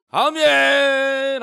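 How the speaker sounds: imitated father's high, long-drawn amen; loud amen. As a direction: belt out a slow amen as the father did. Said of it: "아버지가 큰 소리로".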